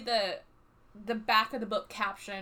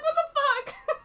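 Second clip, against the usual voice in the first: high, louder, cracking with laughter; laugh-crying outburst; 6 dB louder, slower than usual. Retold or imitated. Friend reacting to the videos, laugh-crying disbelief. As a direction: squeal it through tears. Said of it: "she starts crying".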